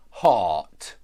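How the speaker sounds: heart with a long ah vowel; ah sound. Shown as a demonstration. Teacher modelling the ah vowel in heart. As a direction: stretch the ah in heart. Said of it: "Hat, hate".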